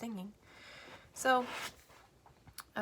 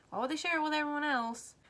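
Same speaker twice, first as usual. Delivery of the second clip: exasperated; 6 semitones higher, 6 dB louder than usual. Real, not acted. Ranting question.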